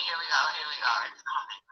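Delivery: ordinary talk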